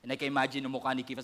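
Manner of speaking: usual speaking voice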